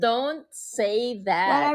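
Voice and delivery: drawn-out hurt protest; slow mock-hurt. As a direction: stretch it out, wounded. (mabagal na kunwaring nasaktan)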